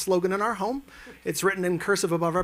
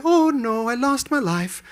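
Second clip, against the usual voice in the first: high, sing-song dying deer voice; narrating roadkill; 5 semitones higher, slower than usual. Retold or imitated. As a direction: voice the dead deer with storybook pathos. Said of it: "Are you narrating roadkill".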